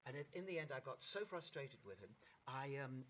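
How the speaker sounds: ordinary talk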